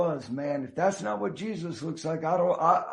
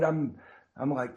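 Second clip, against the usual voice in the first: ordinary delivery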